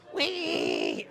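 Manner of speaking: a high, playful whee as he spins the partner. Real, not acted. Instructor spinning his partner around, playful whee. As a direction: sing out a gleeful whee. Playful cry during throw.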